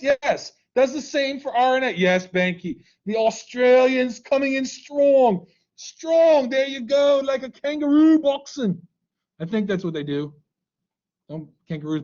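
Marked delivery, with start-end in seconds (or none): 3.1-8.8 higher, put-on Australian cheer; mock Australian accent. Teacher cheering a student as Australian, mock Australian accent.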